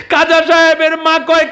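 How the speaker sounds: high, loud, impassioned sermon voice; preaching at full force. Acted. Preacher telling a saint's story to the crowd, at full force. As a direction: call it out to the crowd like a village waz.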